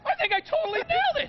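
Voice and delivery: very high, gleeful boast; thrilled. Real, not acted. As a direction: crow about the shot. Celebrating a good throw.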